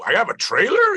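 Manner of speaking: high-pitched, delighted disbelief; retold amazement. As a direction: squeak it like you can't believe your luck. Amazed at getting a trailer.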